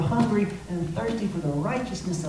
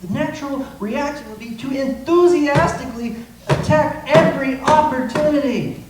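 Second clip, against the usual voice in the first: ordinary talk, nothing marked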